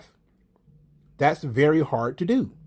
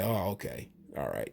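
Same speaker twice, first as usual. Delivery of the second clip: low, soft mock concession; acted-out low, hushed giving in; resigned concession; 9 semitones lower, 13 dB quieter than usual. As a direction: give in quietly, dropping the pitch. Imagined Crews conceding, dropping accent.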